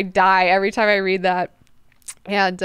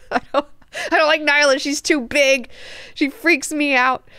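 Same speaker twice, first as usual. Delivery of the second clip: higher-pitched mock whine; freaked-out complaint; 6 semitones higher than usual. Duncan complaining to Leto about Nyla, freaked out. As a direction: protest with hammed-up unease.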